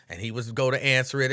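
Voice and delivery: usual speaking voice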